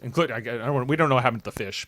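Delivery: usual speaking voice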